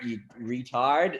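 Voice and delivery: normal talking voice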